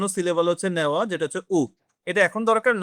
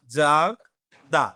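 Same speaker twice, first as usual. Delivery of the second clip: জাগদা drawn out very slowly, syllable by syllable; slowed demo; 4 semitones lower, much slower than usual. Shown as a demonstration. Teacher repeating জাগদা slowly for students, drawn out. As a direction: stretch জাগদা out so the class hears each sound. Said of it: "Then আসতেছে কি?".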